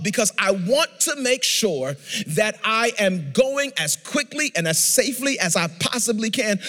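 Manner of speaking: insistent, emphatic pulpit delivery; preaching. Real, not acted. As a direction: drive each resolve home from the pulpit. Preaches the application.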